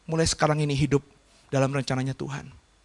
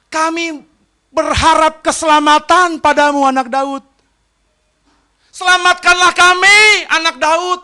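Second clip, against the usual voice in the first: crowd plea; 6 semitones higher, 5 dB louder than usual. Retold or imitated. Crowd pleading to Jesus.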